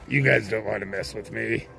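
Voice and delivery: menacing haunted house actor; spooky voice. Retold or imitated. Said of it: "I went into my spooky voice".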